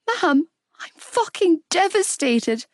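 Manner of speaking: high, slow, breaking voice; falling apart in tears; tearful. A narrator's reading. Sobbing to her mother.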